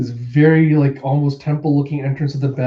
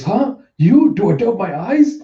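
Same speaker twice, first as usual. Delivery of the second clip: high, disbelieving questions, louder on the second; astonished; 7 semitones higher, 4 dB louder than usual. Acted. Samurai doubting his own eyes, astonished and high. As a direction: pitch it right up in disbelief.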